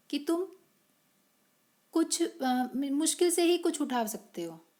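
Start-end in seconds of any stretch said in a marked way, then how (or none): none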